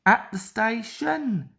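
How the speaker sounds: usual speaking voice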